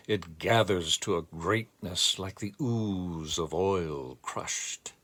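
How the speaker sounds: measured, lyrical reading of a verse; poem recital. Acted. Teacher reciting Hopkins lines to the class, lyrical recital. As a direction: let the lines ring out like a poem.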